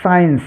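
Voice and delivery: "साइंस" with only the S sounded at the start; silent C. Shown as a demonstration. Teacher giving students an example word, "साइंस" with silent C. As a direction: say "साइंस" with no K sound after the first S. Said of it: "तो सी silent रहेगा".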